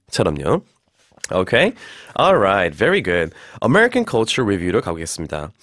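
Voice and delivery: ordinary talk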